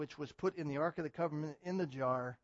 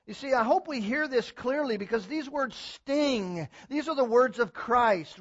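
full-force preaching; 7 semitones higher, 13 dB louder than usual. Acted. Pastor thundering at his church to turn from compromise, loud. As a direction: preach it hard, hammer every warning.

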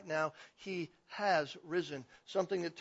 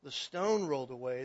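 normal talking voice

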